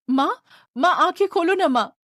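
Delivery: a little one begging mum to open her eyes; frightened child. Acted. Child begging the fainted mother to wake, anxious.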